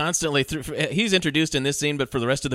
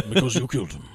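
Depp line reading; 5 semitones lower than usual. Retold or imitated. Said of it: "one of my favorite line readings".

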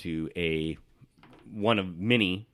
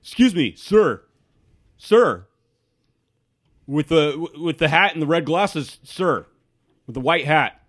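higher, louder insistent guard calling after him; city guard calling out; 7 semitones higher, 7 dB louder than usual. Acted. Guard calling after the wizard in the street, insistent and loud. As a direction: call after the stranger, polite but pushy. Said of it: "You hear a voice say".

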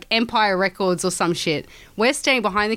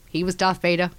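usual speaking voice

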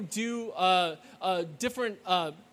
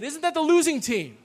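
high, louder taunt at the losing team; taunting cheer; 6 semitones higher, 6 dB louder than usual. Retold or imitated. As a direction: jeer it at the visitors. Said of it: "they looked over at us and they said".

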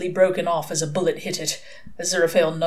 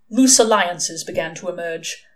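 ordinary delivery

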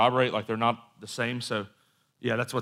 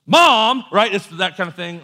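high, loud protesting whine; mock-indignant teen outburst; exasperated teenager; 8 semitones higher, 11 dB louder, slower than usual. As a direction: protest like a teenager being grilled by a parent. Teenager protests parent's questioning, acted.

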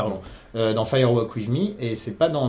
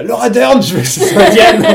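put-on excited childish plea; 9 semitones higher, 10 dB louder than usual. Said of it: "genre à quatre ans, j'étais là".

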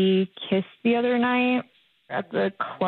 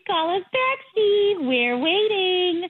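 higher sing-song plea; 7 semitones higher, slower than usual. Acted. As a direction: coax in a high sing-song.

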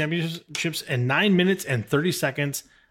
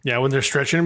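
ordinary talk

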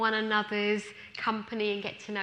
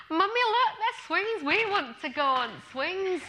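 put-on much higher, thrilled Yorkshire little boy; high excited Yorkshire boy spotting swings; Yorkshire child accent; 8 semitones higher, 4 dB louder than usual. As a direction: squeal for the swings in broad Yorkshire. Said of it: "I'm quite good at that Yorkshire accent".

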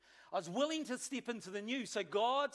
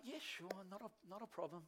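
casual outward agreement; 2 semitones lower, 12 dB quieter than usual. Retold or imitated. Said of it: "on the outside, I'm like".

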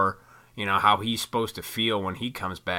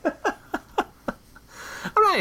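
usual speaking voice

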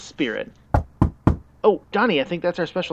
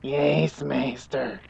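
servile Igor voice; much slower than usual. Acted. Igor answers again.

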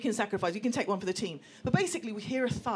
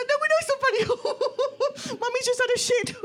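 helpless high squeal through laughter; acting out words lost in a laughing fit; hysterical laughing; 6 dB louder than usual. Hostages answering gunmen, helplessly laughing and squealing. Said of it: "we're just hysterical".

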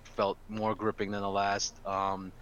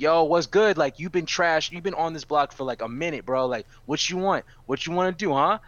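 higher and louder street challenge; tough guy taunt; 6 semitones higher, 6 dB louder than usual. Retold or imitated. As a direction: egg on the other fighter, cocky and aggressive. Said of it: "the other person is like".

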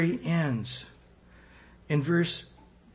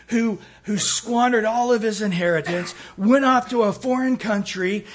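louder, higher, raised voice of protest; older brother confronting his father, indignant and raised; 5 semitones higher, 7 dB louder than usual. Acted. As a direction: demand it like the wronged son who stayed home. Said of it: "But then he's just angry".

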